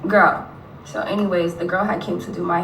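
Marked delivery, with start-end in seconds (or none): 0.1-0.5 calling out in disbelief. Real, not acted. Venting to viewers about the woman, exasperated.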